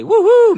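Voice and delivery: high, loud "woohoo" cheer; excited whoop. Acted. Host reading a listener's happy message, excited whoop. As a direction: let out a big high-pitched whoop.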